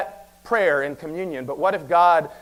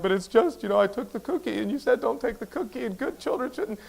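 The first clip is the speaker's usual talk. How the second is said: put-on whiny child; 6 semitones higher, 6 dB quieter than usual. Moping child quoted by parent.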